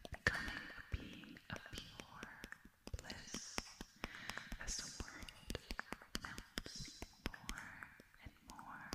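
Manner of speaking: soft whispered trance; hypnotic whisper. Acted. Dissolving the human's mind into the universe, whispered. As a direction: let the words melt away into a whisper.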